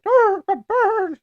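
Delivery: high, slow squawky bird voice. Pretend. Asked to do a bird, streamer squawks like a bird. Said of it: "Do a Bird's Voice".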